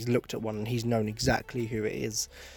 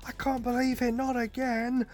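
childish mock scream; 13 semitones higher than usual. Mocking Zeke as he shrieks like a child at Levi. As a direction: whine it like a frightened kid. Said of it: "screams like a child".

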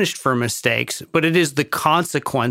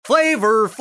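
[name] impression; 8 semitones higher than usual. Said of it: "why it occurred to me to do that".

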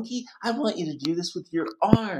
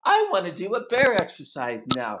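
usual speaking voice

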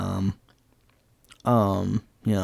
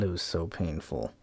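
muted painful; 4 semitones lower, 10 dB quieter than usual. Shown as a demonstration.

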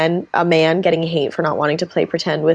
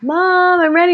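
put-on much higher, eager little-girl voice calling mom; little daughter; 12 semitones higher, 4 dB louder than usual. Daughter calling her mom to play, high child voice. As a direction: pipe it up like a small kid ready to play. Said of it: "you can hear her like".

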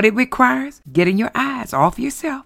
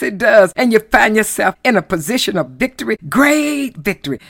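usual speaking voice

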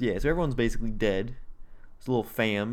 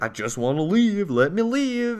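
pitched-up, hammed-up begging; mock desperate pleading; 8 semitones higher, 4 dB louder than usual. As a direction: beg to get away, half in jest. Beaten man begs to leave.

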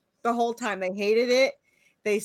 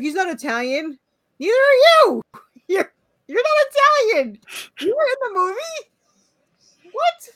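high, loud, outraged rant as John Leguizamo; indignant actor impression; 10 semitones higher, 5 dB louder than usual. Mocking the actor upset over the Mario casting, high indignant squeal. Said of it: "He's like".

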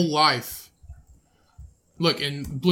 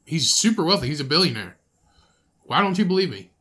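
ordinary talk, nothing marked

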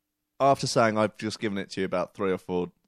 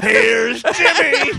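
slow, high, very loud manic horror-film shout with a laugh; Here's Johnny impression; 12 semitones higher, 10 dB louder, much slower than usual. Joking about putting his head through the neighbours' door, manic horror shout. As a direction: bellow it like a grinning axe maniac bursting through the door.

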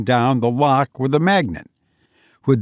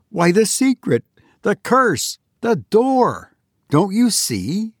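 excited; 8 semitones higher than usual. A narrator's reading. Sudden discovery explained to Clinton.

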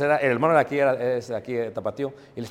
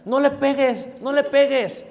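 higher, louder mock scolding of her daughter; scolding grandmother; 9 semitones higher, 5 dB louder, slower than usual. Grandmother telling her daughter not to hit the grandchild, mock scolding. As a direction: play the grandma snapping back at her daughter. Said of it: "qué le dice la mamá a la hija".

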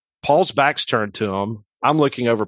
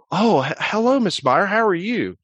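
nervous greeting; 9 semitones higher than usual. Retold or imitated. Paul startled greeting coach's wife.

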